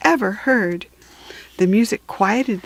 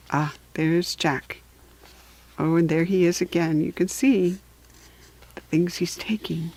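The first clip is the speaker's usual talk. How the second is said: soft aside; 4 semitones lower, 6 dB quieter than usual. Real, not acted. Aside pointing at picture.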